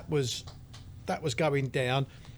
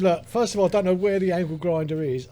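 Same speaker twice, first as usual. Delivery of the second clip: louder, flustered explanation; a mildly flustered louder retelling; 4 semitones higher, 6 dB louder than usual. Retold or imitated. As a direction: raise the voice in a flap. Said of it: "I said".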